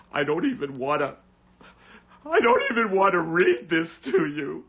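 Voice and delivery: high, loud fake sobbing; mock crying anchor. Imagining Wolf Blitzer weeping on air, hammed-up sobbing. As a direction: blubber through the news like a broken anchor. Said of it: "Wolf is just crying".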